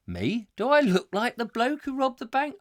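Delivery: high, wide-eyed mock innocence; feigned innocence. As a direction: play dumb, voice rising. Acted innocent reply.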